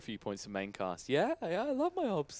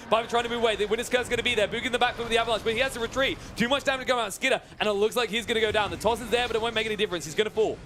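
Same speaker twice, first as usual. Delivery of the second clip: fast, high, loud esports call; excited play-by-play; 10 semitones higher, 5 dB louder than usual. Real, not acted. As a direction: call the fight at full pitch. Live play-by-play of a teamfight.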